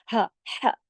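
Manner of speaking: "हा" breathed from the throat; throat sound demo. Shown as a demonstration. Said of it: "ये गले से पढ़ा जाता है ना".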